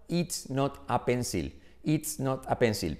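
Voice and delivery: slow, word-by-word Spanish-accented "It's not a pencil"; careful Spanish learner's accent on "It's not a pencil"; Spanish-accented English. Shown as a demonstration. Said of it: "los españoles diríamos".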